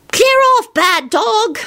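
very high-pitched yelled scolding; Teddy's voice. A narrator's reading. Lupin re-enacting a yell at a biting dog, high-pitched. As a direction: squeal it out like a telling-off for a dog. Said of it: "he finished in Teddy's voice".

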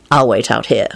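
low, firm instruction. A narrator's reading. Lupin sending Quentin into the store, firm. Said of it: "Lupin instructed Quentin firmly".

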